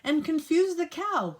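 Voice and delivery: ordinary delivery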